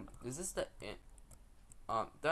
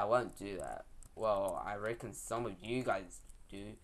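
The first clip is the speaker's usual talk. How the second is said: ordinary talk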